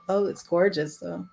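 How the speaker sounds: ordinary delivery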